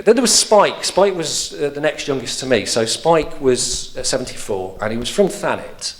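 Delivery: ordinary talk, nothing marked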